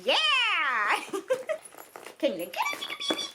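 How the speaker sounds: high quick coaxing voice; pet talk. Real, not acted. Asking her dog to help open the gift in pet voice.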